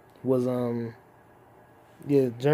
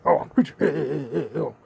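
preacher impression; faster than usual. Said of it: "turned into the preacher".

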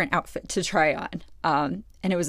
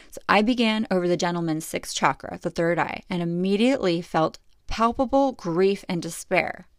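normal talking voice

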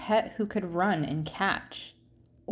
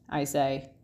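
usual speaking voice

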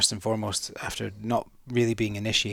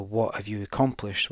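usual speaking voice

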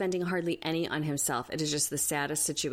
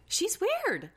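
put-on much higher squeaky baby voice; exaggerated high baby voice. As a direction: push the baby voice way up. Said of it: "has a baby voice".

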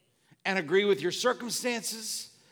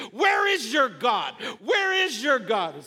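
preached taunting yell; 4 semitones higher, 4 dB louder than usual. Acted. Devil and circumstances yelling at a believer, preached taunting yell. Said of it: "That's what that's yelling at you".

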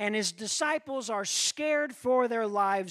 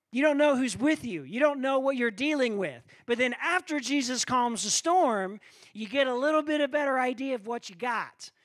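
louder, higher preacher's push; full-force preaching; 5 semitones higher, 6 dB louder than usual. Acted. Preacher building up to the congregation, full-force preaching. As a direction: drive the point home to the congregation.

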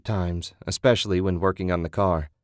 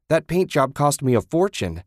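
angry; 6 semitones higher, 4 dB louder than usual. Acted. Ted angry about car.

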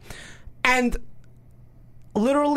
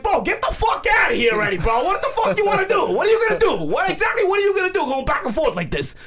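aggravated yell; 9 semitones higher than usual. Retold or imitated. Yelling at the tall guy to back off, furious. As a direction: bark it at him like you've had enough. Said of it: "I get aggravated".

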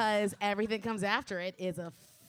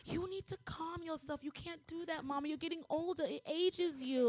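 imitating a calm child; 6 semitones higher, 6 dB quieter than usual. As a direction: play the kid talking his mum down, gently. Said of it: "he looked at me like".

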